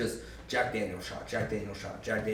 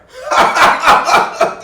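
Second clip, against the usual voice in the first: shrieking laugh; 19 dB louder than usual. Real, not acted. Teller cracks up.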